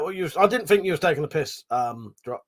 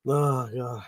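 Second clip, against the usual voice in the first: usual speaking voice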